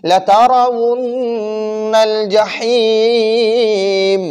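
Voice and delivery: slow, drawn-out tajweed chanting; Quran recitation. Shown as a demonstration. Teacher recites verse for students, slow chant. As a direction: recite it melodically for the class.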